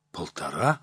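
a low, stunned gasp of a question. Acted. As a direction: gasp at the price. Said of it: "ахнул Егор".

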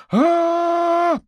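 piercing high shriek. A narrator's reading. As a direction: shriek as loud and high as possible. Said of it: "Вопль из кухни прозвучал ещё громче". (истошный крик)

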